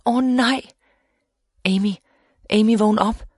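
high, urgent narrated cry; understated panicked cry; restrained scream. A narrator's reading. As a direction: call out in mild alarm. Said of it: "skreg Amy".

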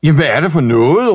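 loud, fast angry shout; shouting father. Acted. Father yelling at Ivan and banging the table, loud fast shout. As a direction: pound the table as you yell. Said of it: "råbte hans far og slog i bordet".

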